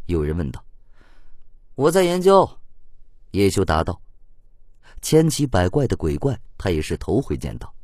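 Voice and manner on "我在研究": higher, louder reply; raised voice, brief reply; answering player. A narrator's reading. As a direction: call the answer back over the crowd. Hero answering a shouted question.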